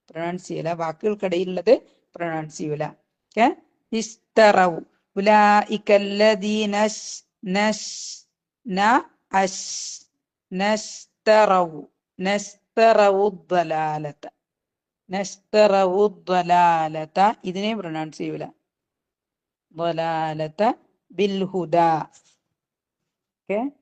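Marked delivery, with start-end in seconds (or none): 3.9-17.3 slow, drawn-out chanted verse; slow tajweed chant; Quran recitation. Shown as a demonstration. Teacher recites verse piece by piece. 19.7-21.8 slow, soft chanted phrase; slow tajweed recitation; Quran recitation. Shown as a demonstration. Teacher recites verse.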